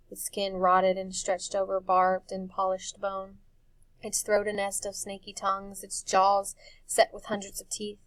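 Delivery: plain reading voice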